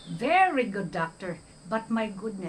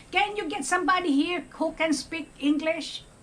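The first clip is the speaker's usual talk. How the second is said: dismissive demand, higher pitched; rude patient demand; 8 semitones higher, 3 dB louder than usual. Retold or imitated. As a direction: imitate the patient brushing her off. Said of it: "he immediately say".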